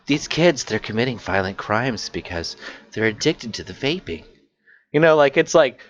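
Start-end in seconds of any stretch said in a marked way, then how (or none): 0.1-4.2 concerned mom act, sinking softer at the end; righteous mock concern. Mocking the mom caller's worry about kids, righteous concern. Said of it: "a little bit of concern, but, like, all just, like, righteous attitude".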